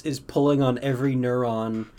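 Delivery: ordinary talk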